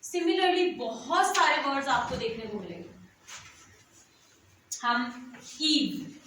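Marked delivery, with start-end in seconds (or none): 4.9-6.1 put-on ईव stretched very slowly for the child; drawn-out word demo. Shown as a demonstration. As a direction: draw the sound out. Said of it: "evening जब हम कराते हैं". (धीरे खींचकर उच्चारण)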